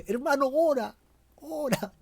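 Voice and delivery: lifted, high-pitched urging with a laugh; high pleading call. Acted.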